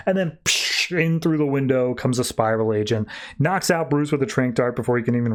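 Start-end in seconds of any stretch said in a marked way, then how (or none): 0.4-0.6 spoken "psh" crash effect; crashing whoosh noise. Retold or imitated. Recapper imitating an agent bursting through the window, whooshing "psh". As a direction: make a hissing burst for the smash.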